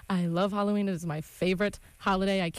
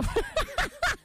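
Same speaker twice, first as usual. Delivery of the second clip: high squealing laugh. Real, not acted. Laughing after the meme.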